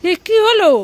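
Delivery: high, loud disbelief; taken-aback mother; startled. Acted. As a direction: exclaim in sudden surprise. Mother discovers the fake wound.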